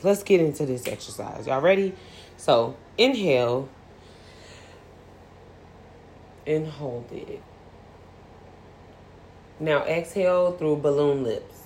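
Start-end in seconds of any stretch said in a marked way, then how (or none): 2.4-11.6 breathing guide. Shown as a demonstration. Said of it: "Let's do a breathing exercise".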